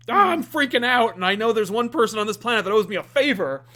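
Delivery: loud, high frantic outburst; panicked yell, pitched well up; Mephisto freaking out. Acted. Host acting out a desperate Mephisto, frantic yelling. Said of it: "it could be Mephisto".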